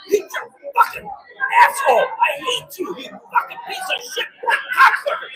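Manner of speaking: imitated neighbor letting it all out; retold venting outburst. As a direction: spit out insults in jumpy, uneven pitch. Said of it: "he just let it all out".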